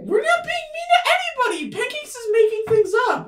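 very high, loud squeaky denial; tiny bully protesting, fast at first; indignant little creature. Acted. Bully mushroom denies it to Ivy.

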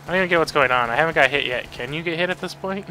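ordinary talk

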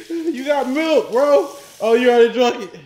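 ordinary talk, nothing marked

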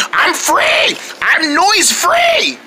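jubilant cry, stretched and rising high; overjoyed. Acted. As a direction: crow it like a freed prisoner. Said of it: "I'm so happy".

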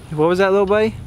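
high, cooing question to a fish. Real, not acted. Talking to his pet fish.

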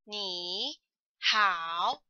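你 and 好 separately, each dipping then rising; over-careful 你 好, each syllable in full third tone; two full third tones. Shown as a demonstration. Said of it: "do we see hello and hi like this?".

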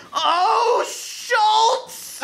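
a hammed-up high climax cry; over-the-top high cry, laughing through it; high cry of release. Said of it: "Linus cried".

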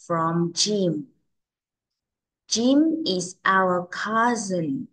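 slow model reading with gaps between phrases. Shown as a demonstration. Teacher models a dialogue for children to repeat, very slowly. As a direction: read very slowly, word by word for learners. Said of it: "Cô sẽ đọc rất là chậm".

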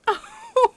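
very high, loud delighted shriek-laugh; high squealing giggle. Real, not acted. Overjoyed at Nico.

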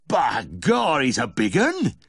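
loud, high-pitched Yorkshire farmer; exclaiming farmer. A narrator's reading. Farmer on seeing the newborn calf, loudly exclaimed. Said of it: "exclaimed the farmer".

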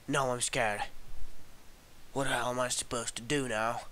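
ordinary talk, nothing marked

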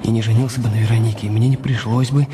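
low, brooding inner voice; husband brooding over his rich wife, low; inner monologue. Acted. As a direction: think it darkly, half to yourself.

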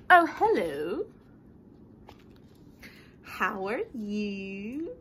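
sugary over-polite pigeon; butter them up sweetly; a hint of a suspiciously polite pigeon; too polite pigeon. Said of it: "He's being too polite".

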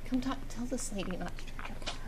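quiet aside. Real, not acted. Aside to husband, hiding from caller.

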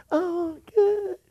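high, sugary put-on "Oh, good"; a mock pious grin in the voice. Pastor mocking a churchgoer, high sugary put-on cheer.

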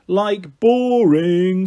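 slow, loud, sneering jab; scornful drawl. Acted. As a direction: drag the word out with contempt. Chloe sneers at party games.

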